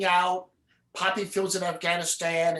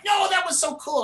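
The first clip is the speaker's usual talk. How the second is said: lightly imitating fans, much higher; mock excited protest; 11 semitones higher than usual. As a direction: play the guys insisting it was cool. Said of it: "the guys are like".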